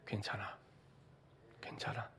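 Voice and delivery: God consoling a frightened believer in a whisper; whispered reassurance. Acted. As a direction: breathe "it's okay" in a whisper.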